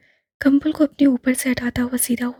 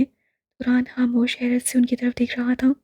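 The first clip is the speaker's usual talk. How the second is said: ordinary delivery, nothing marked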